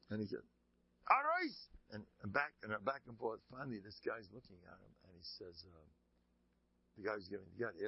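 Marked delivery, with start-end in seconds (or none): 1.1-1.7 one high, loud burst; acted indignant shout. Rabbi to interrupting gabbai, acted indignant shout. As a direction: cut him off with staged outrage.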